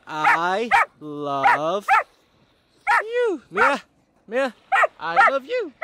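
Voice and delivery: very high, squeaky I love you; cutesy dog voice. Pretend. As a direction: coo it like a lovestruck puppy. Voicing the dog's affection.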